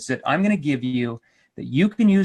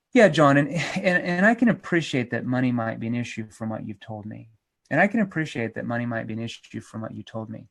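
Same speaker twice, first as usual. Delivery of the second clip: empathetic; 5 semitones lower than usual. Shown as a demonstration. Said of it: "I'm showing empathy".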